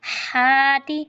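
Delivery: हाति with a lot of breath on ह; breathy ha. Shown as a demonstration. Teacher showing the breathy ha. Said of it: "हा पर खूब सांस जारी करें".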